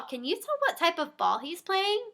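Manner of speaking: plain reading voice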